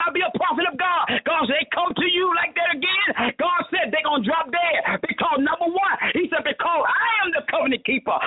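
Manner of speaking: high, driving preacher's cadence; full-force preaching. Real, not acted. Preacher warning off the enemies of God's prophets, forceful preaching.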